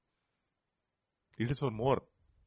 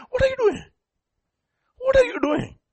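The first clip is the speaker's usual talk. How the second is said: high incredulous what are you doing; shocked disbelief, voice jumping up. Pretend.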